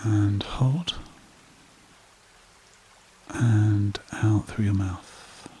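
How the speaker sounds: slow meditation voice with long pauses; guided meditation. Acted. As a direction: guide listeners gently into calm, phrase by unhurried phrase.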